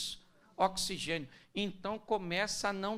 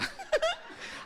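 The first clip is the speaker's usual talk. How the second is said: high burst of laughter. Real, not acted. Preacher laughs at own joke.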